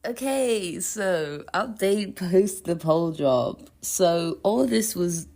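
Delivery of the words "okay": usual speaking voice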